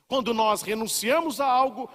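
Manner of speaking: ordinary talk, nothing marked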